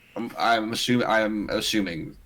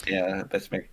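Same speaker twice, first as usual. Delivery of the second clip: ordinary talk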